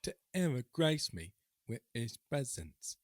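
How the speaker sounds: put-on British accent. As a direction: act out a British rant, unhurried. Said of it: "But in Britain, you know".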